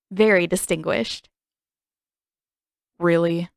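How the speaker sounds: ordinary delivery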